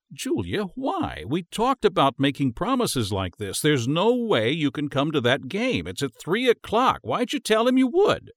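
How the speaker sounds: frustrated disbelief at a promise; exasperated. A narrator's reading. Husband exasperated rebuke.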